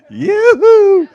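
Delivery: very high, loud laughing yoo-hoo; whooping with laughter; gleeful whoop. Real, not acted. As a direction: call out like you're flying up. Joking about going up in rapture.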